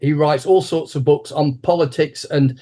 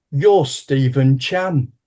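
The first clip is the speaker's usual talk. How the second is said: slow blurt; much slower than usual. Retold or imitated. Blurts name, frozen with nerves.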